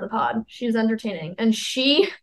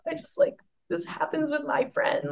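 put-on tearful drunk friend; 6 semitones higher, 8 dB quieter than usual. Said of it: "she was like".

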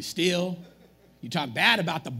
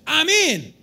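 eager; 9 semitones higher, 9 dB louder than usual. Retold or imitated. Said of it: "we all go".

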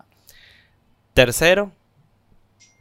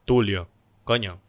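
slow, much lower man's voice; mock deep introduction; 6 semitones lower, slower than usual. A club stranger introducing themselves, slow low mock man's voice. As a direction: drop the voice low and slow for the reveal.

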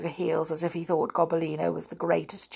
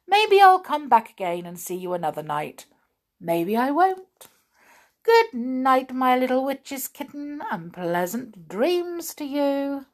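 teasing hobgoblin; 7 semitones higher, 3 dB louder than usual. A narrator's reading. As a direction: taunt the cat sweetly. Hobgoblin's mocking goodbye.

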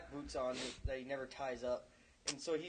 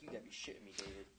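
low, much softer, nearly whispered; hushed disbelief; 6 semitones lower, 11 dB quieter than usual. Retold or imitated. Retelling reaction to fifty-year-old's age, hushed disbelief. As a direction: drop to a stunned undertone, imitating.

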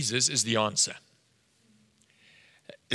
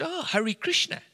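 much higher, rising echo of the answer; mock-intrigued echo; 13 semitones higher than usual. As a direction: act out a high-pitched "oh, really". Echoes the waiter's answer.

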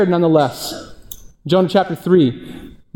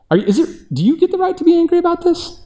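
high, incredulous question; 12 semitones higher than usual. Real, not acted. Pastor echoing God's question to Jonah, incredulous high voice. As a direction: ask it in disbelief, voice shooting up. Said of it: "I love God's response".

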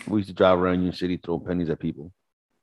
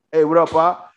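mock cheerful; 8 semitones higher, 6 dB louder than usual. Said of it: "I'm like".

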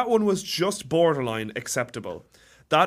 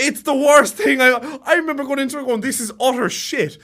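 loud rant; 8 semitones higher, 6 dB louder than usual. Real, not acted. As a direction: rant it, fully disgusted. Ranting against Shrek Four.

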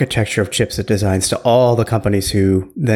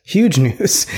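sarcasm broken by a laugh; higher mock hype with a chuckle inside; sarcastic; 4 semitones higher than usual. Real, not acted. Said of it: "I'm being sarcastic".

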